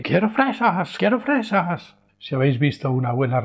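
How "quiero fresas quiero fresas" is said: put-on high whining child interrupting; mock wail of a boy; child's tantrum.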